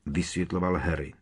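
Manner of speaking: plain reading voice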